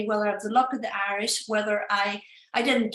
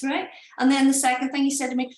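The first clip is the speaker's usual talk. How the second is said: ordinary talk, nothing marked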